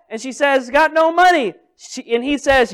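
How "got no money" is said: lightly acted, loud high protest; hammed-up plea, high and loud; mock protesting widow. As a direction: answer the collector back, voice pitched up. Acted widow answering the creditor.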